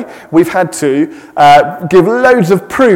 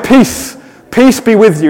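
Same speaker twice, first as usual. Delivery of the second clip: higher, ringing peace, peace be with you; proclaiming greeting; 5 semitones higher, 4 dB louder than usual. Shown as a demonstration. Pastor redoing Jesus' greeting properly, higher and ringing. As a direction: announce it with real power. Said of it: "No, of course he said".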